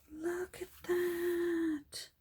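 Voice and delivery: very slow, high wonder; hushed awe. Real, not acted. Crafter marvels at somebody's old scrapbook, hushed awe.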